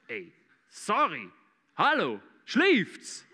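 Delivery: drawn-out, affronted mock comeback; indignant. Preacher answers a stranger who called him boy, indignant. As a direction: stretch each word in put-on disbelief.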